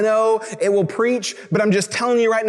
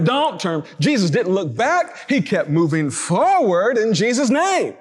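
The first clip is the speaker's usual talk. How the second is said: slow, swooping emphasis on "back" and "forward"; big mock emphasis; slower than usual. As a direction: imitate a church exhorter stretching "forward" out big. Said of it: "we put a big emphasis on that".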